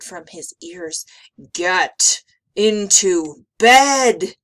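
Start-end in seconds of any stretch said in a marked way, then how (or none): 1.5-4.4 very loud, slow monster command; thundering. A narrator's reading. Gabe looming over Emma, thundering order. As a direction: thunder it out word by word. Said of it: "Gabe thundered".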